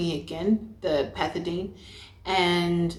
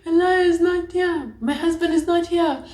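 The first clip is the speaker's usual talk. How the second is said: mock crying; 10 semitones higher than usual. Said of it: "I start crying".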